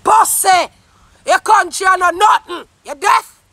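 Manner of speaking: real, heated shouted tirade; angry shouting. Real, not acted. Ranting at critics of their complaints about Jamaica, angry shouting. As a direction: shout down a challenger in outrage.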